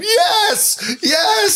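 high overjoyed cry of yes; crying out yes in tears; joyful shout. Retold or imitated. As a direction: cheer like you're standing in the theater. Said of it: "just said".

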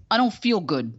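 lightly put-on sulky accent; a hint of a grumpy impression; Scott Baio. Imitating the man's answer to her greeting, light impression. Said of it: "like that".

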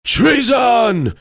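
very high, loud cry of alarm; outraged shout. A narrator's reading. King Pest accuses Tarpaulin, shouting high. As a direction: shout it high, holding the last syllable. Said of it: "shouted Majesty King Pest the First".

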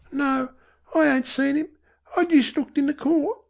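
put-on high-pitched young Cockney boy; boy's voice. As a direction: answer as a child, pitched high. Boy answers mother in story.

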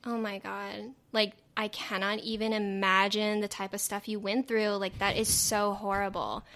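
exaggerated, disingenuous Valley girl accent; Valley girl impression. Shown as a demonstration. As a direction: gush fake sympathy like a Valley girl. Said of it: "can you do a Valley girl impression".

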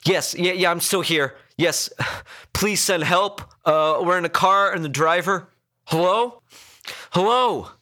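high-pitched, panicky caller; frantic call for help. A narrator's reading. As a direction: beg the operator for help, stumbling. Emergency call for help, losing connection.

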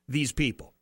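ordinary delivery

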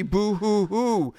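mock boo hoo sob; whiny pretend blubbering; fake crying. Mocking Batman's grief.

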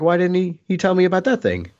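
hammed-up eager complaint; mock excited why-didn't-he; imitating enthusiasm. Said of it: "he was very enthusiastic".

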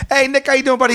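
high-pitched, eager greeting; hammed-up chummy hello; buddy Joe. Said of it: "my buddy Joe came out".

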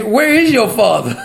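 talking through real laughter; laughing. Real, not acted. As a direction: retell it while still chuckling. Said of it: "I just be laughing".

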